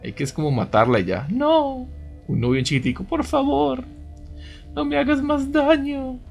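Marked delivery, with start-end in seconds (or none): 1.3-6.2 put-on high, slow begging girl's voice; pleading girl voice. Lee a la chica que suplica. (voz aguda de chica suplicante)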